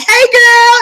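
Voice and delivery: put-on high, loud sing-song "hey girl"; sing-song greeting. The neighbor driving by, calling a high, loud hello. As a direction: sing it out like a fake friend. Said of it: "she be like".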